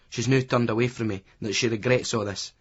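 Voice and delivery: usual speaking voice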